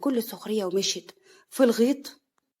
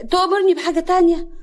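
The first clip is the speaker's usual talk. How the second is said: much higher, louder question; insistent repeat; 8 semitones higher, 8 dB louder than usual. Acted. Woman repeating her offer to a dazed man, raised voice. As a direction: repeat it brightly to get his attention.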